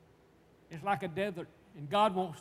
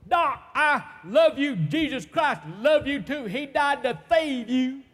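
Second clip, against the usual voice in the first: high, drawn-out joyful holler; slow, full-voiced shout across a room; 6 semitones higher, 12 dB louder, slower than usual. Patient calling to the doctor at the door, hollering. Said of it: "I could holler now".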